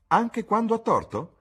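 loud, very high incredulous query; challenging question. A narrator's reading. Duchess challenging Dorian's loyalty to Harry, high-pitched. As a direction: challenge it with sharply lifted pitch.

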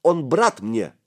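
put-on fiery small-boy outburst; hot-headed shout. A narrator's reading. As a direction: insist hotly. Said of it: "запальчиво выкрикнул мышь".

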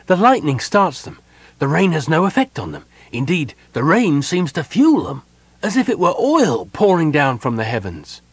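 high, urgent account; alarmed report. A narrator's reading. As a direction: report disaster over the storm. Runner reports unquenchable fires.

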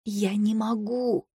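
very soft, slow and faint; weak, drawn-out near-whisper; voiceless mouthing. A narrator's reading. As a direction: barely get the words out, voice gone. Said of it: "произнесла я одними губами".

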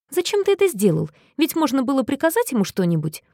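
high fast indignant protest. A narrator's reading. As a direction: object with outrage, quickly. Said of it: "возмутился Мэтью".

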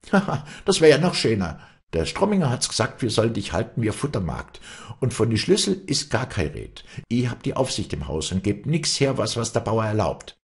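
high, scoffing maid after a laugh; freche Magd, spöttisch. Acted. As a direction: scoff and refuse her outright. (freche Magd, höhnisch abweisend)

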